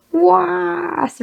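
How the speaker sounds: "was" drawn out long and pitched high; stretched, high-pitched roar of "was"; deafening war cry. Shown as a demonstration. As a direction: bellow "was" as one long open vowel. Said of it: "much longer and ... at a deafening".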